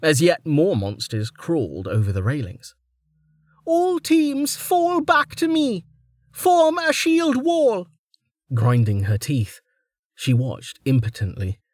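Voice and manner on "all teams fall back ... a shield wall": high, drawn-out commander's shout; battle command. A narrator's reading. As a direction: call the troops back over the fighting. Commander rallying fighters mid-battle.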